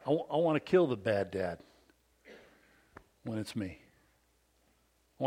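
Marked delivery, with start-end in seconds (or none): none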